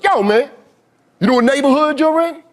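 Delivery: high, mocking street-tough voice; imitating a thug. Comic imitating street thugs confronting him. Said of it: "thugs walk up to me like".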